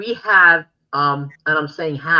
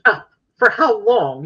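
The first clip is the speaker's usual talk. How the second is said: mocking retort; 6 semitones higher, 6 dB louder than usual.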